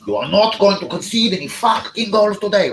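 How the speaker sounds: high, loud mock German coach voice; hammed-up German accent. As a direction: bark it like a strict German manager. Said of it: "I'm trying to do a German accent".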